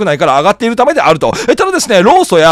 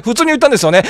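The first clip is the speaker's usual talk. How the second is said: ordinary talk, nothing marked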